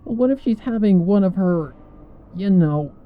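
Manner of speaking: worried, hushed-urgent question; Rainbow's voice. A narrator's reading. Rainbow fretting to the others about Twilight.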